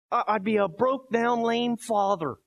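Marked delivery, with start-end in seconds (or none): none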